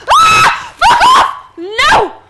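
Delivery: high, loud shrieking panic; terrified scream. Real, not acted. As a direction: scream and shove it away. Monster teleports close.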